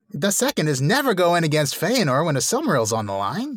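high-pitched comic lecturing; a hint of gloating joke villain; mock-villain parody. Topping cohost's joke about blunders, high mock lecture.

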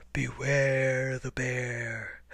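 low, slow growly bear call; great bear voice, drawn out; booming growly bear. A narrator's reading. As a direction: stretch it out as a deep growl. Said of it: "a great growly voice boomed out".

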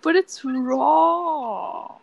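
drawn out. Acted. Drawn-out joke line.

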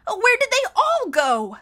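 high, loud and slow bewildered cry; frantic shout. A narrator's reading. Mister Poe shouting across the lit theater. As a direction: call out into the empty theater.

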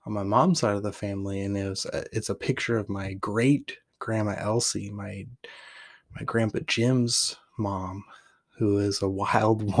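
usual speaking voice

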